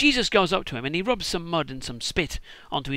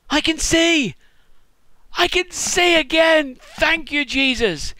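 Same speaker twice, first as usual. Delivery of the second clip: much higher, louder ecstatic cry; joyful shout; 9 semitones higher, 6 dB louder, slower than usual. Pretend. Blind man cured by Jesus, ecstatic loud shouting. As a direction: shout with overjoyed relief. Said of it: "blind guy rubbing mud and going".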